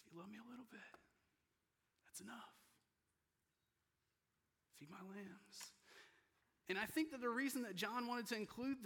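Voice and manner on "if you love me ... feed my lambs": soft, higher, tender voice; gentle forgiveness. Acted. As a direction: forgive him quietly, unhurried. Jesus gently forgiving Peter, acted.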